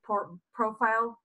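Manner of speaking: ordinary delivery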